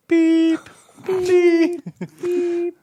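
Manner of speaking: long high-pitched beeps; high, loud, drawn-out beep noises; reversing beeper. Pretend. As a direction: imitate a truck's backup alarm. Imitates a vehicle backing up.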